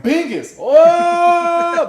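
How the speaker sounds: very high, loud imitated holler; hammed-up cry with a long, held howl; mock wailing cry. Retelling the friend's outburst while playing, high mock wail.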